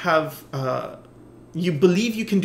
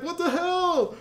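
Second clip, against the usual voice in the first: much higher, dramatic adventurer voice; mock heroic bewilderment; 12 semitones higher, 3 dB quieter than usual. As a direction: play a shocked explorer turned grandiose. Rower meets cannibal.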